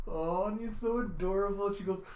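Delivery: ordinary delivery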